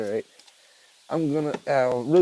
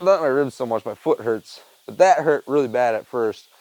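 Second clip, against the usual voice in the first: ordinary delivery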